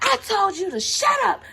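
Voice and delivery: grandma shrieking at the granddaughter; grandma yelling. Retold or imitated. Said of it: "And she was like".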